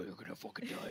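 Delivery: whispered low threat; menacing whisper. Pretend. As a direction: hiss the threat under the breath. Sudden threat after a peace offer.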